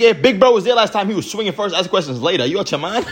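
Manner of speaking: worked-up disbelief, fast and high; excited. Real, not acted. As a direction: hype him up, can't believe it. Excited claim about Big Bro.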